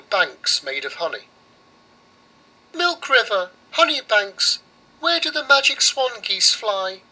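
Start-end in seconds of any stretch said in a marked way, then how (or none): 2.7-7.0 put-on high pleading little girl; girl begging the oven, voiced high; girl's voice. Girl asks the oven for help, high and earnest.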